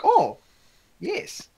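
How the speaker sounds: high-pitched mock British reply; put-on British voice. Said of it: "why I made that voice".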